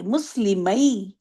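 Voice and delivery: مسلمئی said slowly, the final ئی gliding down; drawn-out demo word. Shown as a demonstration. Teacher shows students the falling sound, slow and gliding. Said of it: "آواز کے travel ہونے کی بھی دیکھیں کہ اوپر سے نیچے".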